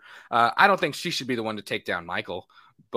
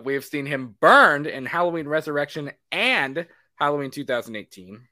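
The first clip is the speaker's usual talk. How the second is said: ordinary talk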